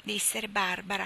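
ordinary delivery

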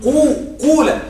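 long rounded oo in قولة; pure damma. Shown as a demonstration. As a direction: say قولة with a full u vowel. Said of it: "هنا واو وضمة خالصة".

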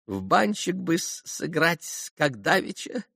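high, tittering little voice; giggling old hanger-on. A narrator's reading. Maximov suggesting a card game, giggling. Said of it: "хихикнул вдруг Максимов".

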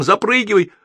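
high-pitched urgent call; yelled order. A narrator's reading. Crew calling their comrade aboard, high urgent yell. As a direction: holler for him to climb on. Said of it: "заорали мы ему хором".

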